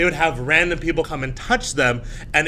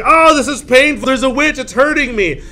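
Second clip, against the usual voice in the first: high, put-on wail of pain; hammed-up victim complaint; acted pained cry; 8 semitones higher, 7 dB louder than usual. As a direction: play a hurting little girl crying out. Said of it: "Sadie was like".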